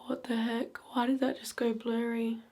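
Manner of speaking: hushed, unnerved question; startled whisper. Real, not acted. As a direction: whisper it, spooked. Same reaction repeated.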